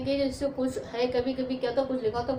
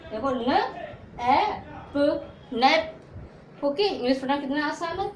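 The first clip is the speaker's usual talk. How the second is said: usual speaking voice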